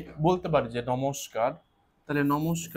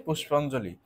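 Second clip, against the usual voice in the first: usual speaking voice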